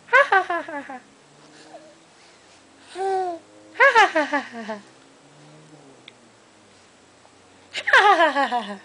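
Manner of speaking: bright fake laughing to coax a giggle; ha-ha, bouncy and playful; demo laugh for baby. Shown as a demonstration. Mom laughing to get the baby to laugh.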